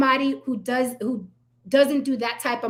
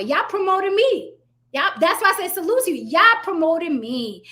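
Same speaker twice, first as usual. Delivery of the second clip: gloating; 7 semitones higher, 3 dB louder than usual. Real, not acted. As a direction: savor it like a victory. Gloating at critics.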